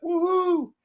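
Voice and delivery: a real happy whoop about the repair; excited whoop. Real, not acted. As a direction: cheer it out like good news just landed. Cheering that the Bobcat gets fixed.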